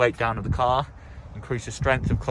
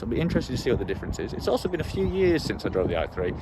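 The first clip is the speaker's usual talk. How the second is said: ordinary talk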